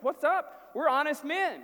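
high, loud innocent protest; startled protest. Retold or imitated. Brothers protesting to the steward, high surprised. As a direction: yelp it out, wide-eyed innocence.

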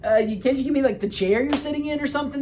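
mock whine from the brother left with nothing; whiny Esau begging. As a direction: beg like a cheated sibling angling for scraps. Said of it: "that's what I can hear him saying".

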